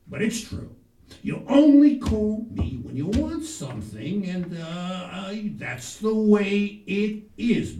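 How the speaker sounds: loud parental grievance; mock-aggrieved dad on the phone; complaining father.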